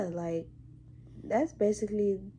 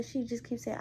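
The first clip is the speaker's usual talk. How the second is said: ordinary talk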